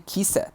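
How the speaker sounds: "kiss it" as two syllables, a wrong "kiss-ed"; extra-syllable -ed mistake. Shown as a demonstration. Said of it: "we don't say".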